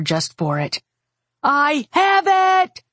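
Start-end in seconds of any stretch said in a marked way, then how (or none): 1.4-2.8 high, drawn-out scream; high-pitched urgent yell. A narrator's reading. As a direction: scream it out urgently, stretching the words. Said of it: "I screamed".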